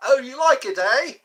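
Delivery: a chirpy British voice; British accent. Retold or imitated. British accent for chat praising the impression. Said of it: "That's Eric Idle".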